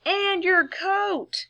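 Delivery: slow, high called-out reminder; mother calling out slowly; yelled reminder. A narrator's reading. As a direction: stretch it out calling after him. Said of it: "yelled his mother".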